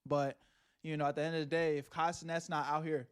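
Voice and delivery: ordinary talk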